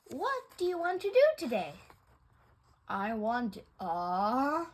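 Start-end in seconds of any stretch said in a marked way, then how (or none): none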